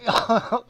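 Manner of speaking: high loud laugh. Real, not acted. Laughs at own story.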